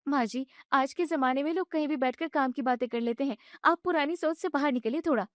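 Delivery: put-on young wife protesting. Bride objects when mother-in-law shuts off the gas, indignant and high. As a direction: push back, hurt and annoyed.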